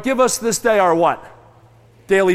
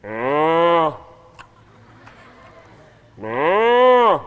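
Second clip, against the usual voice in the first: contented cow sigh; 4 semitones lower than usual. Retold or imitated.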